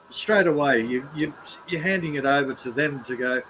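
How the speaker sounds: ordinary delivery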